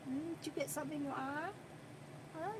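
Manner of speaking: quick, soft question to the pet; hushed pet talk. Real, not acted. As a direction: murmur it fast and close. Softly checking the pet's eye.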